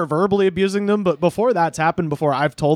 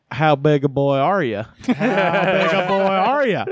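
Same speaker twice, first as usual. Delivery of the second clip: put-on Southern accent for a taunt; hammy Southern accent; slower than usual. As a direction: tease in a stretched-out Southern accent. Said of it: "start off in a Southern accent".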